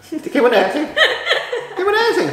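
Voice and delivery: giggling, squeaky and high; laughing high-pitched teasing. Pretend. Teasing, laughing at partner dancing.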